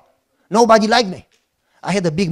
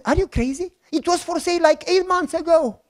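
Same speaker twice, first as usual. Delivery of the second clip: incredulous; 7 semitones higher, 4 dB quieter than usual. Retold or imitated. Astonished at the buyer.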